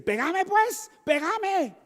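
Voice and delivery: high, taunting voice; mock provoking wife. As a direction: dare him to hit you. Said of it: "las mujeres dicen". (esposa provocadora, voz aguda y burlona)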